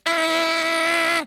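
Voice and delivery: high imitated long horn yell "Ah!"; angry horn blast. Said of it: "Not a kind of toot toot, more of a".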